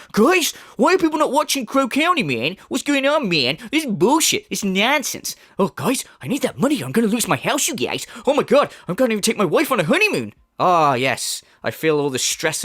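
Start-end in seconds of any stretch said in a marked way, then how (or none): 0.1-10.3 high, whining mock streamer voice, pitched up; whiny mocking impression. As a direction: pitch it up and whine like a spoiled streamer. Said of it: "and he's going".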